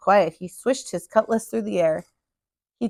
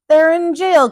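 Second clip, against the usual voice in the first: higher, louder alarmed cry; 6 semitones higher, 8 dB louder, slower than usual. A narrator's reading. Dots cries out that the crew is jailed, loud alarm. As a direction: call out the bad news. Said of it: "cried Dots".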